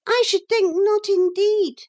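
very high, thin scorn; thin, disagreeable voice. A narrator's reading. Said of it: "a thin and disagreeable voice".

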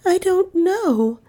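high, slow, weak answer. A narrator's reading. Niece evading the detective with a lie, weak and high. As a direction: falter weakly, drawing it out high. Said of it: "she said weakly".

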